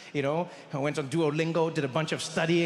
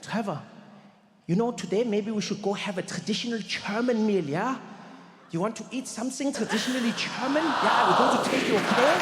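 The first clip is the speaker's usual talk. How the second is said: put-on German-accented English, higher pitch; German accent, building louder; Rolf; 5 semitones higher, 3 dB louder than usual. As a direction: imitate an eager German friend. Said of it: "Rolf turns to me and he's like".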